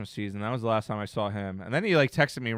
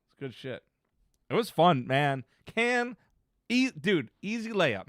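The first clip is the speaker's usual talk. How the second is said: usual speaking voice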